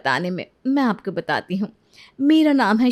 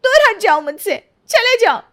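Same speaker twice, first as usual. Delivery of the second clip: much higher and louder, push him away; angry shout; 10 semitones higher, 6 dB louder than usual. Acted. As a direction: scream at him to back off. Wife pushes husband away. (पति को दूर हटाते हुए चीखना)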